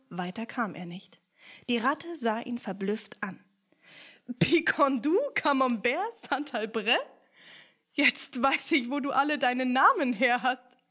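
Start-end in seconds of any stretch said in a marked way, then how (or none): 4.4-10.8 louder, higher surprised rat; dawning realization. Acted. Rat realizes where Picondu's name comes from, surprised. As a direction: puzzle it together, then realize.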